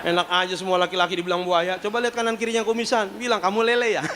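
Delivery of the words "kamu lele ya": chuckling mock question; laughing tease. Teasing joke line. (menggoda sambil tertawa)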